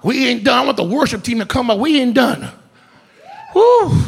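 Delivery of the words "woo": excited whoop. Real, not acted. Pastor whoops in worship.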